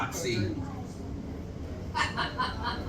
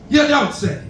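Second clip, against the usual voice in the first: high, loose imitation of Spanish; mock Spanish accent; 8 semitones higher than usual. Shown as a demonstration. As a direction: run it together like Spanish, pitched up. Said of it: "sounds like Spanish".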